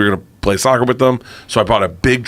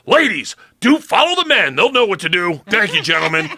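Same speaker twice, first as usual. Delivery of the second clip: high, pompous hammed-up condescension; mock chauvinist; 7 semitones higher than usual. Guide ordering the wives to follow the men, high pompous mock. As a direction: lord it over the ladies in a squeaky pompous voice. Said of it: "he got into character so good".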